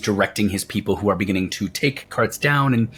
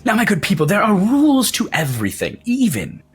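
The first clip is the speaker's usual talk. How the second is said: Wolfgang, showman's voice. Acted. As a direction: proclaim it like a pompous ringmaster, pitched up. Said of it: "he goes".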